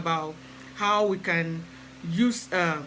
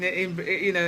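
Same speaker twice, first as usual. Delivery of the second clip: ordinary talk, nothing marked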